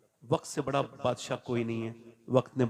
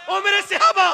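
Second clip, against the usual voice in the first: raised, high rallying call; 14 dB louder than usual. Retold or imitated. Preacher retells the Prophet summoning his companions, calling out. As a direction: build up to a high, loud summons.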